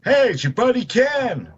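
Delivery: playing Ken. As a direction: slip into a goofy buddy character. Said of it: "I'll stand in for you".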